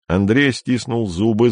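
plain reading voice

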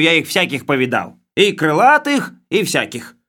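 high, loud, bossy bureaucrat voice; blustering official. Acted. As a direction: lay down the law like a boorish boss. Khlebovodov's blustering speech.

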